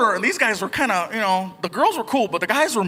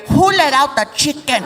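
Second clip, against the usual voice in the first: mock mom; 5 semitones higher, 5 dB louder than usual. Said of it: "And I'll get".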